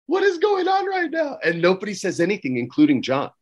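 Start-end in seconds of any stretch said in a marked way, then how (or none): 0.1-1.4 much higher, incredulous question; baffled disbelief. Retold or imitated. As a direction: ask as if you can't believe your eyes. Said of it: "And I'm like".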